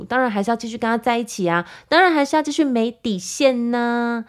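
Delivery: sarcastic. Real, not acted. Mocking her past self to listeners, sarcastic. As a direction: say it with heavy irony. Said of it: "我现在是在反讽哦".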